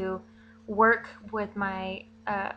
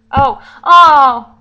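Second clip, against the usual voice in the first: startled; 5 semitones higher, 20 dB louder than usual. Real, not acted. Realises recording still running.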